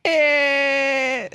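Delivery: very high, strained comic German; a held high noise, then a drawn-out German yes; mock German accent. Shown as a demonstration. Demonstrating a joke German accent, high and silly. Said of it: "that's my German accent".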